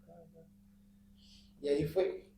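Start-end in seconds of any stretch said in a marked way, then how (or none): 0.1-0.4 barely audible filler for a prayer; murmured prayer. Retold or imitated. Passenger sketching out his silent praying, murmured. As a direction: mumble the rest of the prayer away. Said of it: "y comienzo a orar".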